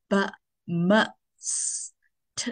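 "But, m, s, t" as bare short sounds: buh, muh, sss, tuh; isolated consonant sounds. Shown as a demonstration. Teacher listing consonant sounds, each isolated and short. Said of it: "they all are called consonant sound".

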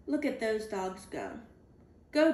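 plain reading voice

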